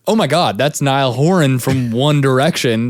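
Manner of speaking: starstruck. Retold or imitated. Opener recalls spotting his idol at sound check, starstruck. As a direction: blurt it out like an amazed fan. Retelling spotting a famous singer.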